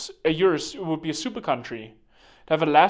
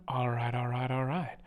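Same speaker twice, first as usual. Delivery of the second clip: actor impression; 3 semitones lower, 8 dB quieter than usual. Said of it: "what's that actor?".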